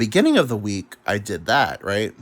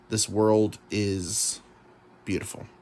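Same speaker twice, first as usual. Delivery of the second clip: slow dreamy emphasis; 4 dB quieter, slower than usual. Real, not acted. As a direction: draw out "this world is beautiful" very slowly.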